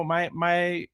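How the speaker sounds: ordinary talk, nothing marked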